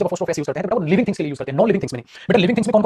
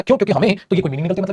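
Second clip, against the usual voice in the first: ordinary talk, nothing marked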